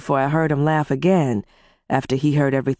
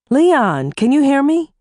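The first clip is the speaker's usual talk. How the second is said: raised, higher voice; calling out over a bad line; 11 semitones higher, 5 dB louder than usual. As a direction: call loud down a crackly line. Shouting over bad phone line.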